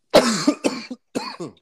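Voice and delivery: loud, high hacking cough; a harsh real coughing fit. Real, not acted. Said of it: "Oh, damn, bro".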